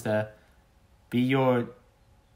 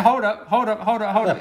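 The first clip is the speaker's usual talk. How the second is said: interrupting; 6 semitones higher than usual. Retold or imitated. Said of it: "I'll be like".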